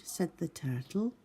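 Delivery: plain reading voice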